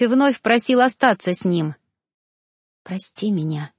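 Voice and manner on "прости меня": whispered. A narrator's reading. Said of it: "шептала ему мысленно".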